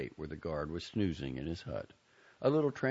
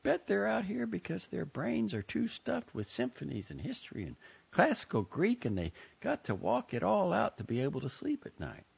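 put-on higher girlish voice; lightly voiced young woman. A narrator's reading. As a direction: voice the sister, curious. Joni voiced high.

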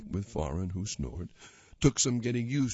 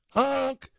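car horn honk; 7 dB louder than usual. A narrator's reading.

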